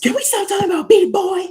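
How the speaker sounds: mock-furious high-pitched yell; exasperated outburst. Streamer pleading with viewers to drop a character, exasperated yell. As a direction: screech it fast in comic exasperation.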